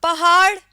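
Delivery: पहाड़ said clearly, पे and हे apart; pronouncing पहाड़. Shown as a demonstration. Teacher to students, पहाड़ said with a clear separate h. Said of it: "इसको हम कहते हैं".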